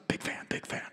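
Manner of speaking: whispered confession; sneaky whispered fan admission; secret whisper. Retold or imitated. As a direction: whisper it so no one hears. Officer secretly admits fandom.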